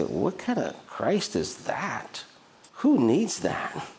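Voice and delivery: imitated scornful questioning; mock disdain. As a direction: act out an unimpressed onlooker, stretching the words. Voicing put-off onlookers judging believers.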